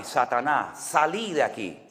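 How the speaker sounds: imitating an Argentine pastor's accent, lightly done; a hint of Argentine voseo in a prayer against Satan. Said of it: "porque allí tienen esa forma de hablar".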